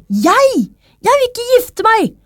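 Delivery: put-on high-pitched, indignant girl's protest; shocked refusal. A narrator's reading. Min Chao refuses to marry the king, high shocked voice. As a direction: squeal a startled refusal. Girl refuses the king's proposal.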